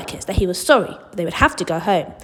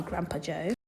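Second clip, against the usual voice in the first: ordinary delivery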